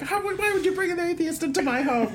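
high, indignant mock yell; hammed-up shriek of disbelief; retold screaming. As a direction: imitate an outraged row at home. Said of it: "screaming at her parents".

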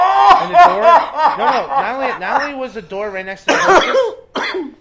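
high, loud laughing squeal; laughing hysterically. Real, not acted.

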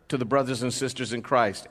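normal talking voice

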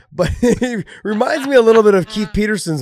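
high, loud laughing talk. Real, not acted. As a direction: laugh through the sentence. Laughs while comparing voices.

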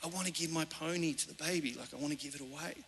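hushed, very soft confiding; retold whisper. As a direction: imitate a child telling a secret in the ear. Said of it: "she whispered in my ear".

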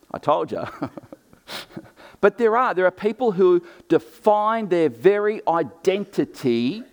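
ordinary talk, nothing marked

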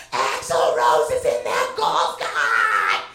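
slow, loud, drawn-out shout of the name; losing it shouting. Retold or imitated. Fan screaming to husband about [name] in golf cart, full-out shout. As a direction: bellow it like you just lost your mind. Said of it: "I fucking lost my shit".

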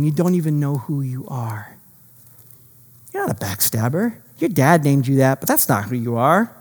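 low, soft voice of God; quietly and tenderly correct him, with weighty pauses; hushed reassurance. Acted. God gently renames Jacob, acted.

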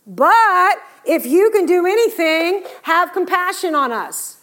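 high, loud and slow, begging; desperate plea. Acted. Preacher voicing the father begging Jesus, high and loud. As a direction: plead like a father at his wit's end.

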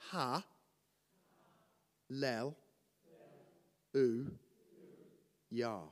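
Ha, lel, u, yah spoken slowly, one syllable at a time with pauses; teaching a congregation to repeat each syllable. Shown as a demonstration. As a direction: break Hallelujah into Ha, lel, u, yah. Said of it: "You've gotta say this after me".

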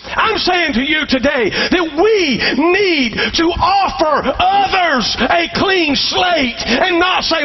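rising, slow, shouted sermon climax; stretched sermon shout; full-force preaching. Acted.

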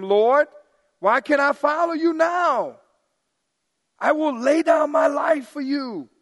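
impetuous Peter shouting his vow to Jesus; Peter's bold vow. Acted. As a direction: shout it like an eager disciple.

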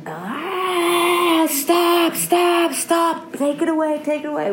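high, pleading cry to stop; frightened plea. Retold or imitated. Arjuna cries out to Krishna in fear. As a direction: beg the god to take the vision away. Arjuna overwhelmed by divine vision.

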